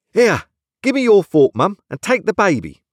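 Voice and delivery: high, loud brisk nurse; urgent high-pitched servant's scolding; bossy nurse. A narrator's reading. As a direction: order the mistress about like a busy nursemaid. Nurse orders Missus Pocket about.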